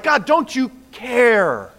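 a hint of a believer's frustrated lament; complaining prayer. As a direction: ask God why he seems not to care. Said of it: "we're like".

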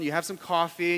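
ordinary delivery

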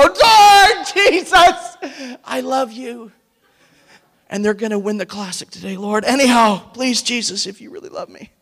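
mock-earnest plea to the Lord; hammed-up praying for a hockey win; pleading prayer. Playful prayer for his team.

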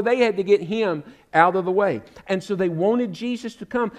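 ordinary talk

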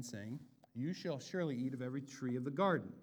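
ordinary delivery

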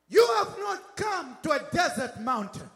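forceful sermon in driving parallel phrases; rousing pulpit declaration, punching the phrase openings; emphatic preaching. Real, not acted. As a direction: press each contrast home to the congregation.

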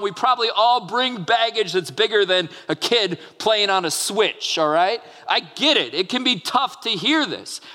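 preaching at full force. Acted. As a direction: lift it up like a pulpit proclamation. Preaching scripture to congregation.

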